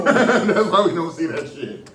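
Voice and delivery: slow words through a laugh. Real, not acted. Laughing at own joke.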